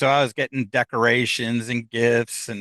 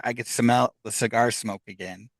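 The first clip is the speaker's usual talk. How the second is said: ordinary delivery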